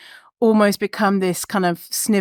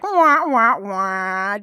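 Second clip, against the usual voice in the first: sad trombone wah wah; much slower than usual. Retold or imitated. Telling a friend about the diet links, mock sad trombone. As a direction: sing a mocking sad trombone. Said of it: "then I was like".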